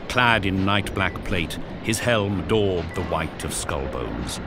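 ordinary delivery, nothing marked

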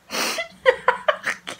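airy giggle. Real, not acted. Doesn't know what to do.

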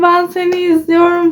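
yüksek perdeden heyecanlı bir "ay"; heyecanlı hayran taklidi. Retold or imitated. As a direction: heyecandan nefesi kesilen hayran gibi. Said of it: "heyecandan konuşamıyor". (otobüs durağındaki hayranı heyecanlı taklit ediyor)

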